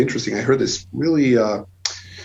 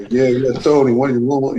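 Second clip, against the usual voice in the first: put-on light New York mobster accent, a bit louder; a hint of a Goodfellas New York wiseguy; 3 semitones higher, 4 dB louder than usual. Joking about touring New York, light Goodfellas New York accent. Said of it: "it was like Goodfellas in my head".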